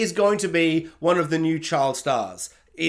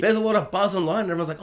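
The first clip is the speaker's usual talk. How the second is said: ordinary talk, nothing marked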